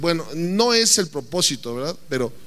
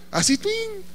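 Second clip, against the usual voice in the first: arrow whizzing sound; 13 semitones higher, 4 dB quieter, slower than usual. Acted.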